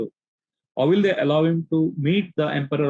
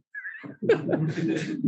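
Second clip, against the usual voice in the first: high laugh; 6 dB quieter than usual. Real, not acted. Laugh while quoting Maharaj.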